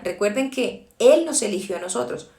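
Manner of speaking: usual speaking voice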